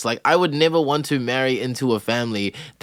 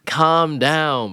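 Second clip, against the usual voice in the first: ordinary talk, nothing marked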